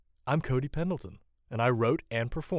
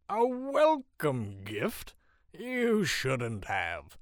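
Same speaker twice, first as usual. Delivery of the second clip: very slow, swooping mock gratitude; loud, high start, teasing slur; drunken jest; 4 semitones higher, 4 dB louder, much slower than usual. A narrator's reading. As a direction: draw it out in boozy fake modesty. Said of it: "the man jests".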